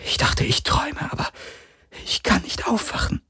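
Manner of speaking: high, breathless whisper; frightened whisper. Acted. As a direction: whisper as if still dreaming. Kirai still shaken, whispering.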